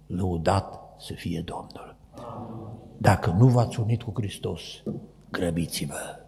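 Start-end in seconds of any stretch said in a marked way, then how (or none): 0.1-2.7 slow, hushed whisper of "Lăudat"; whispered praise. Acted. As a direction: whisper it reverently, softer. Preacher drops into hushed praise. („Lăudat să fie Domnul” șoptit, rar)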